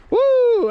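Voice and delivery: high, loud whoop of triumph; excited whoop. Real, not acted. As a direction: cheer as the fish lands. Landing a fish.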